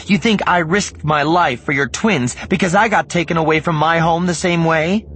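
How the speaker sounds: sharp, high and loud; indignant outburst. Acted. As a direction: push back hard, offended. Mara flares up defensively.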